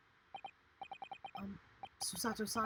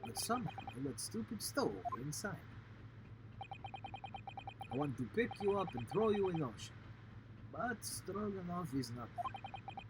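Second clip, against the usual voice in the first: Russian sailor voice; 3 semitones higher than usual. Acted. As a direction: threaten lightly in a Russian accent.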